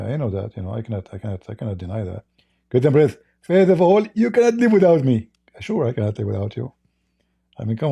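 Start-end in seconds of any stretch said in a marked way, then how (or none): 2.7-5.3 much higher, louder put-on voice of his opponent; squeaky mock rival, smug and pleased; mocking Zakir Naik. Debater mocking his rival by answering in his voice, high and squeaky. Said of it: "What do you think, Zakir Naik?".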